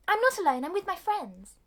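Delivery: hammed-up high voice in reply; imitated young cat. Cat answers Macavity, high and insistent. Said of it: "She's like".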